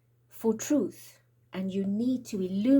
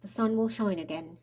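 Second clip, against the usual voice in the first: ordinary talk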